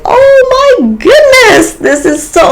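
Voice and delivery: overjoyed, near tears. Real, not acted. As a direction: let joy crack into a cry. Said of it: "Ah, I could have cried, like, tears of joy".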